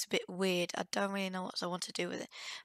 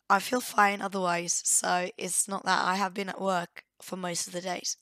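ordinary talk

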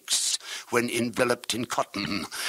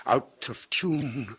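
ordinary delivery

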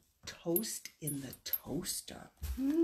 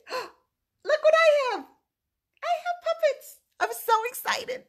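much higher and louder, thrilled; excited gasp; 9 dB louder than usual. Real, not acted. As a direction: gasp and show the surprise. Reveals puppets to child.

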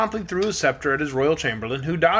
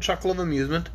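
ordinary delivery, nothing marked

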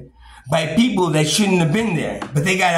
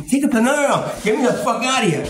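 high-pitched mock argument; retold agitated quarrel; 7 semitones higher than usual. Staff and pissed-off patient arguing, mock agitated. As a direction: play out a heated back-and-forth, pitched up.

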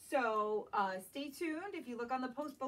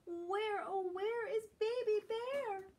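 high, carrying call for a lost cub; Mama Bear calling; 9 semitones higher, slower than usual. Acted. Mama Bear searching for her missing cub, calling high. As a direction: call out high across the woods. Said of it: "calls Mama".

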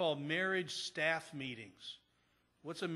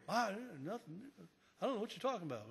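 higher, softer mock denial; evasive brush-off; 5 semitones higher, 3 dB quieter, much faster than usual. Church member dodging the pastor's question, evasive. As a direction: imitate someone dodging the question. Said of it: "He wasn't willing to talk about it".

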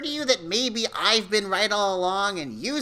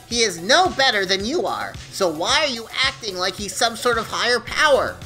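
indignant challenge; 10 semitones higher, 6 dB louder than usual. Acted. Demon challenges Todd about boss.

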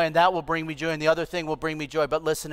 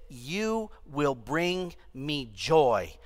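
dreamy vow; 5 semitones lower, 6 dB quieter, much slower than usual. Retold or imitated. Young lovers' dreamy vow.

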